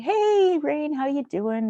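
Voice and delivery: high, slow, chummy greeting from the idle boss; a mock-friendly drawn-out hello; imitating the CFO. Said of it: "he would come into my office and".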